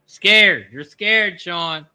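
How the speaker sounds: taunting. Real, not acted. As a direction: jeer at your friend. Taunting friend after timeout.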